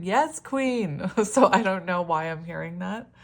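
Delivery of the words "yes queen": sassy yes queen. Retold or imitated. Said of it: "but I was hearing".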